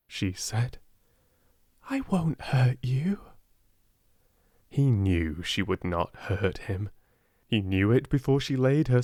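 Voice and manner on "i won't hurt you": coaxing. A narrator's reading. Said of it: "Speaking in a coaxing voice".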